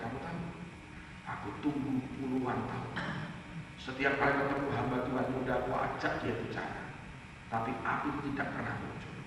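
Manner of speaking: put-on hushed, low retelling of an old man's confession; restrained emotion. Grandpa confides his long wait to the preacher, restrained and hushed. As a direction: imitate someone holding emotion in, very softly. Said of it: "dia kendalikan emosinya".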